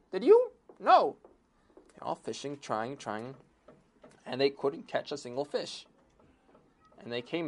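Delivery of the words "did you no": fisherman voice. Acted. Fishermen calling between boats.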